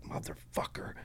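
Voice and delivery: soft, voiceless whispered swear; retold muttered curse. Zip-tied brother answering him during the raid, whispered curse. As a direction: curse under your breath.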